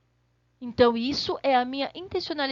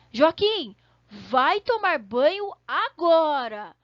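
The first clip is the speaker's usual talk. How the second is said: a louder, slow stern mother calling her son; mother's command; 2 semitones higher, 6 dB louder, slower than usual. Retold or imitated. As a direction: call Joaquim to the bath like a firm mom. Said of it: "a pessoa fala assim". (mãe mandando o filho ao banho, mais alto e devagar)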